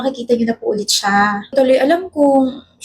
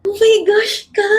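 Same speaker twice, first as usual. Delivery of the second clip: high, thrilled squeal; excited squeal; 6 semitones higher than usual. Acted. Girl learns her companion joins the Rome trip, excited squeal. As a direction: squeal it out in delight.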